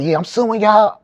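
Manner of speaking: loud, high cocky threats; arrested man taunts the police, loud and cocky; talking trash. Retold or imitated. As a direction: mouth off at the cops. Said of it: "I'm talking hella shit".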